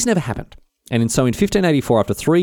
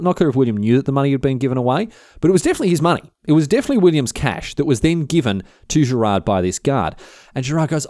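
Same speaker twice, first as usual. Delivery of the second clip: ordinary talk, nothing marked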